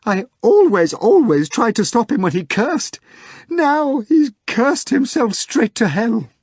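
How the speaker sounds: high, loud, slowing despairing wail; screaming secretary. A narrator's reading. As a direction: sob it out in despair.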